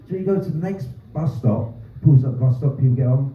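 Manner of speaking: usual speaking voice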